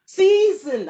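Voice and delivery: "Season" cried high and loud, delighted; eager exclamation. Acted. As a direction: pounce on "Season" as you remember it. Seizing the right word.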